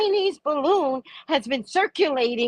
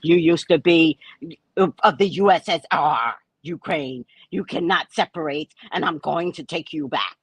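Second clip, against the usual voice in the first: Putin; 10 semitones lower, 4 dB louder than usual. Acted. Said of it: "Putin's like".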